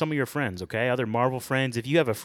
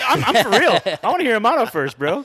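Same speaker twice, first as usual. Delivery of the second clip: loud; 10 semitones higher, 9 dB louder than usual. Real, not acted. Insists loudly Amato goes first.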